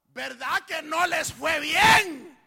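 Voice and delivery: high, slow shouted question; loud, drawn-out challenge; confronting question. Acted. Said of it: "la pone en forma de pregunta".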